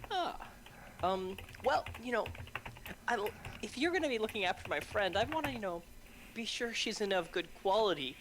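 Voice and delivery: in-character player. Acted. Player asking the grandmother for a visual menu, mildly put-on. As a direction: play a fussy customer who wants to see the goods.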